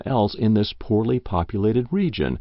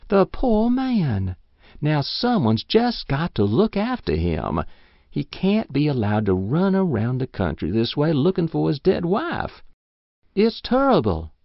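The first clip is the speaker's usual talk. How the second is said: put-on pitying old woman; 7 semitones higher than usual. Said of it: "sighed Missus Race".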